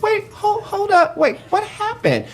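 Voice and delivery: high, shocked falsetto; startled. Retold or imitated. Caught off guard by the angry host, high startled squeak. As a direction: squeak it out in alarm.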